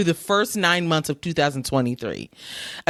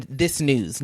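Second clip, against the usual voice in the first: ordinary talk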